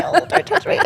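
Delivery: high mock-offended squeal. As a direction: squeal it as a fake protest. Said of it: "I'm like".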